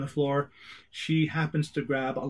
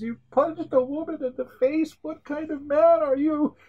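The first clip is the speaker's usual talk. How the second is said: higher, put-on voice, half-crying indignation; tearful outrage; 13 semitones higher, 3 dB louder than usual. Wife on the floor scolds the agent, higher tearful indignation. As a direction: wail it like a hurt, wounded woman. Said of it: "She breaks down, crying".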